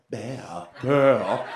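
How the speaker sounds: usual speaking voice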